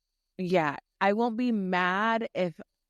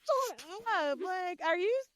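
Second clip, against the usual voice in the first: much higher, incredulous mock outrage; exasperated disbelief; 12 semitones higher than usual. Girlfriend retelling snapping at her boyfriend, incredulous and high. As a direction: play it like you can't believe he's serious. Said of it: "I'm like".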